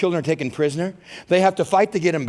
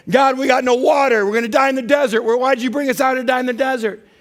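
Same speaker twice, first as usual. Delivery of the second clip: high, loud whiny protest; mock whining; 7 semitones higher, 4 dB louder than usual. Preacher imitates Israelites complaining to God in a loud, high whine. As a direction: wail it like a spoiled crowd. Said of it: "in chapter twenty".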